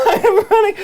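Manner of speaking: laughing through the words, high. Real, not acted. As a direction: giggle it out. Laughing while making risky move.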